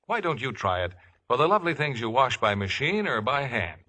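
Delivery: ordinary talk, nothing marked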